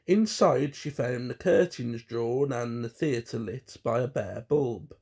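ordinary delivery, nothing marked

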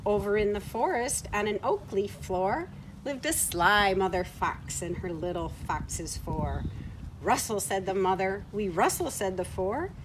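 lively, sing-song storybook reading; reading to children. Acted. Reading a farm story aloud to children at home, lively storybook voice. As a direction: read it brightly to little ones.

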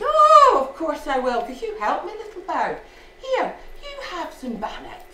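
high-pitched acted quote, loud "Oh"; eager, generous Jock. Jock sharing bannock with the robin, eager and high. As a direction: burst out high, happy to help.

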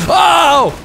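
high startled yelp; surprised shout. Real, not acted. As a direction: jolt out a cry. Sudden shout in game.

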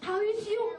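usual speaking voice

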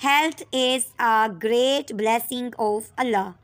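very slow, clear dictation; dictation pace. Shown as a demonstration. Teacher dictating a sentence, very slow. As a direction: read it out word by word for writing. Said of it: "इसको हमने use करके एक sentence में किया".

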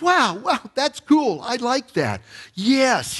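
high-pitched, wowed new convert; mock enthusiasm. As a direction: act out an instant, gushing yes. Voicing an eager new believer.

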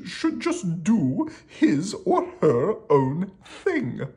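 high, loud, pompous king's decree; hammed-up kingly announcement; royal proclamation. A narrator's reading. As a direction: proclaim it grandly to the whole kingdom. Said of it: "he made a new proclamation".

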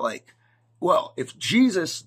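ordinary delivery

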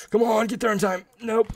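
high-pitched urging; urgent plea. Real, not acted. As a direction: strain upward as if willing it to arrive. Urging his move in game.

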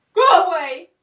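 loud, high grumpy outburst. A narrator's reading. Muddy Pig to the curious farm animals, grumpy. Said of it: "said the heap grumpily".

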